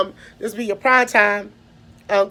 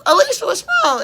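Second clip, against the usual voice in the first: concerned principal; 9 semitones higher, faster than usual. Retold or imitated.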